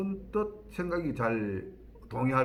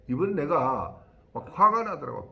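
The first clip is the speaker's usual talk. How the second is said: ordinary talk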